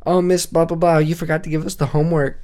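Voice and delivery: mocking nerdy student. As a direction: mimic a know-it-all student to mock him. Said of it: "I would mock them".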